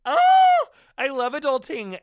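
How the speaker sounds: over-the-top thrilled about being grown up; gushing delight. Acted. Host gushing to listeners about adulting, squealing with delight.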